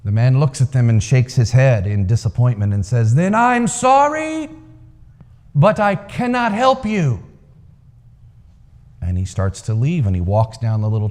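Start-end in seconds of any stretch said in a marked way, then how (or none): 3.1-7.2 high, slow solemn pronouncement; grand old man. A narrator's reading. Old man refusing the confused crowd, high and solemn. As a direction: lift it up and let it land slowly. Old man's disappointed verdict.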